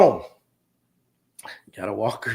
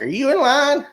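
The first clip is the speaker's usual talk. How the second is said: put-on much higher, frail old-person asking; 10 semitones higher than usual. Teller imitates an old customer at the pharmacy, high and frail. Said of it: "they're like".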